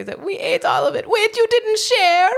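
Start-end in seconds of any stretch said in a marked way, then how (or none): 0.2-1.1 imitating a pig admitting the cake; squeaky pig voice. Imitating the pigs in the story. 1.1-2.3 high, slow mock outrage; mock indignant Shrek. Shrek voiced in the retelling.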